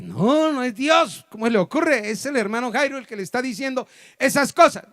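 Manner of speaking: high, loud, indignant retort; a sister loudly insisting it is just Jairo talking; loud protest. Retold or imitated. Sister loudly contradicts the preacher.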